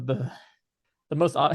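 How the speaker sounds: breathless, panting between words; out of breath. Real, not acted. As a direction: talk while catching your breath. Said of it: "I'm out of breath".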